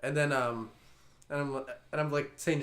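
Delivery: ordinary talk, nothing marked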